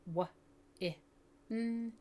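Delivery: W, I, n said as single separate sounds; separated sounds. Shown as a demonstration. Teacher tapping out win for a child, sound by sound. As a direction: tap out W, I, n one sound at a time. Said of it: "I tap a finger down for each sound".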